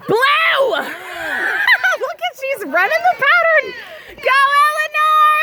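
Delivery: high, shouted race cheering; excited cheering. Real, not acted.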